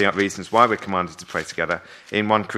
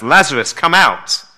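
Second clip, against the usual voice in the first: higher, louder call; loud command; 6 semitones higher, 9 dB louder than usual. Acted. Jesus calls the dead man out, loud command. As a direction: ring out the summons.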